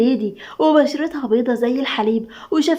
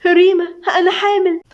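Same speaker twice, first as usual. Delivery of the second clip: high, raised-voice cry; screamed announcement; 7 semitones higher, 4 dB louder than usual. A narrator's reading. Friend springing pregnancy news on Rima, screaming. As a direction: call it out in a sudden high shriek. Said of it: "وقالت بصريخ".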